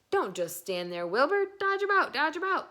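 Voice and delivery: high, loud honking goose; crying goose. A narrator's reading. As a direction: shout it out like an excited goose. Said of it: "cried the goose".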